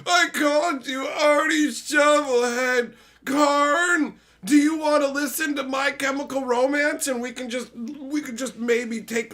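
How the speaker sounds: overwrought emo meltdown, wailed out; Teferi sobbing apology. Retold or imitated. Teferi begging Karn's forgiveness, loud mock sobbing wail. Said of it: "Teferi's like".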